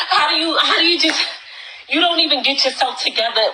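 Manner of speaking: high, strained disbelief; stunned at the mess, voice climbing; exasperated. Real, not acted. Shocked at the mess.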